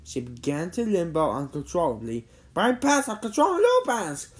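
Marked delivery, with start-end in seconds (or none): 2.5-4.3 high-pitched alarmed cry. A narrator's reading. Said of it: "cried Jimmy".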